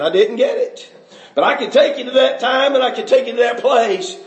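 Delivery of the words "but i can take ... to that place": ordinary delivery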